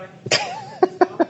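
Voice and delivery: mock squeal. Mock stressed outburst.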